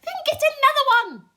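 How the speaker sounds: high, loud snappy centipede. Acted. Centipede telling the glowworm to get another bulb, high and loud. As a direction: brush her off. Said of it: "the centipede said".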